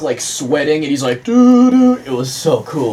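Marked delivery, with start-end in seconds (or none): 1.2-2.0 loud sung music cue; humming a sting. Retold or imitated. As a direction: belt the little sting.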